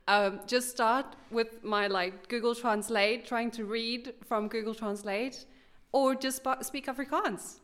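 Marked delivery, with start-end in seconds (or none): none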